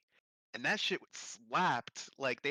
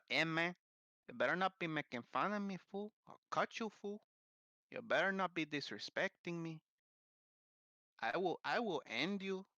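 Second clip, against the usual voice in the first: mock Chicano tough guy; 4 semitones higher than usual. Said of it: "doing accents by mistake".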